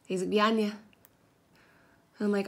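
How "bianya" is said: quick, run-together Scottish reply; imitated Scottish accent. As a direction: imitate a Scotsman slurring two words into one. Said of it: "with the Scottish accent".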